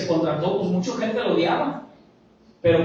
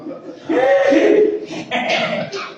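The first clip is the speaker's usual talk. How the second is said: high shrieking mock laughter; 4 dB louder than usual. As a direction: cackle like the scoffers. Mockers laugh at Jesus. (carcajada burlona aguda)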